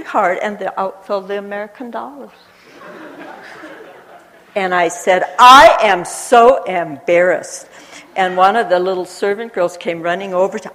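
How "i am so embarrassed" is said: slow, loud dismayed cry; said really loud. Retold or imitated. Woman finding her money in her room, loud slow dismay. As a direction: blurt out the shame loudly. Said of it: "Because I said it really loud".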